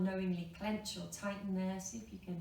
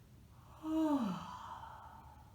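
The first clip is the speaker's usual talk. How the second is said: long sighing yawn, falling away; 8 semitones higher than usual. Shown as a demonstration. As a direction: let a big yawn-sigh out.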